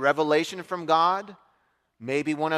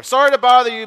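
mock apology; 6 semitones higher, 11 dB louder than usual. Neighbor repeats his apology.

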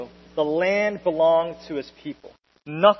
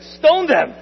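much higher and louder crowd cry; angry crowd shout; 11 semitones higher, 11 dB louder than usual. Acted. The congregation rejecting Joshua's plea, furious shout. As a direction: bellow it like a furious mob.

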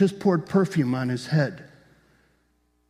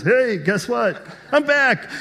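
high-pitched, drawn-out excited call; gleeful announcement; 8 semitones higher, slower than usual. Acted. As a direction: call it out high, like a big surprise comeback. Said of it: "comes jumping out like this and says".

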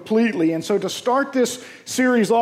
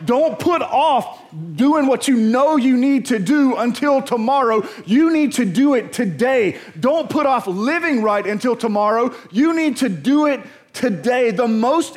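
higher, punchy pulpit exhortation; emphatic preaching; 4 semitones higher than usual. Real, not acted. Preacher urging the congregation not to put off living, emphatic preaching. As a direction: drive each phrase home to the congregation.

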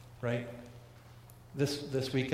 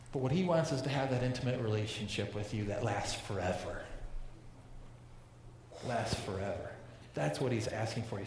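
tender appeal; 3 semitones lower, 5 dB quieter than usual. Real, not acted. Pastor's tender closing appeal.

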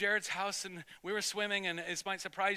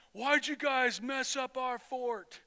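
slow, higher mock bully demand; mildly accusing; 5 semitones higher, slower than usual. Bigger boy confronts the pair over a wrecked fort, mildly accusing. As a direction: draw out the accusation a little.

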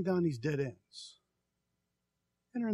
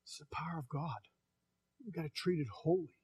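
hushed whisper, much softer; 8 dB quieter than usual. Real, not acted. Preacher hushes the congregation, whispering. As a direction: breathe it like a holy secret. Solemn point about holy power.

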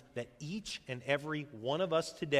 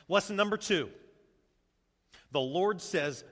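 ordinary delivery

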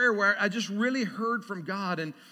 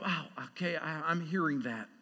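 hushed awe; 7 semitones lower, 10 dB quieter than usual. Retold or imitated. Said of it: "and you go".

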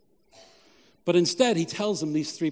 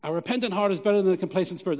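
"A repentant heart is better than a complacent spirit" run together fast with no pause; quick restatement; 2 semitones higher, faster than usual. Shown as a demonstration. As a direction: rattle the point off quickly as a recap. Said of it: "repeated as a key point".

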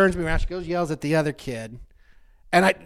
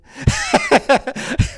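loud high laugh bursting out; burst of laughter; 11 dB louder than usual. Real, not acted. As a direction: crack up at the joke. Laughs after the vexing quote.

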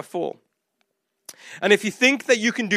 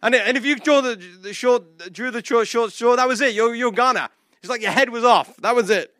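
ordinary delivery

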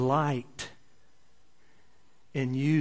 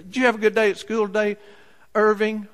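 motherly question; 6 semitones higher, 5 dB louder, faster than usual. Acted. Mother asking son.

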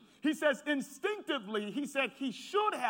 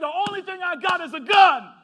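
retold desperate cry; 7 semitones higher, 11 dB louder than usual. Gunman's confession retold.

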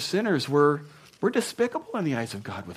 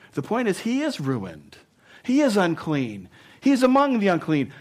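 building emphasis; 7 semitones higher, 6 dB louder than usual. Real, not acted. Preaching climax.

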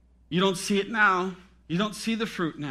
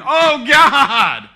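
loud, high wail to God; imitated anguished cry; mock screaming and crying; 8 semitones higher, 13 dB louder than usual. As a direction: wail it like someone in a storm, hammed up. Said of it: "You're screaming and crying and".

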